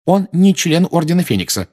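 high, loud insistent statement; heated objection. A narrator's reading. Mrs Weasley quarrels with Sirius. (громко возражая)

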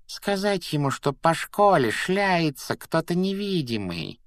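high, drawn-out imp voice; high-pitched sly pondering; musing poltergeist. A narrator's reading. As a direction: threaten to tattle in a squeaky singsong. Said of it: "задумчиво проговорил Пивз".

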